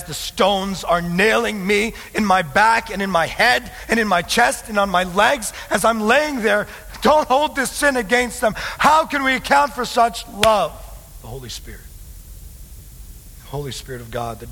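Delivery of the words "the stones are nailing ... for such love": high, forceful sermon voice; impassioned preaching. Real, not acted. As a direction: preach it in astonishment at full force.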